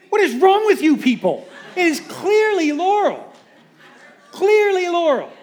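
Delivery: hammed-up disbelief at the crowd; escalating comedy rant. Mock outrage over Laurel debate.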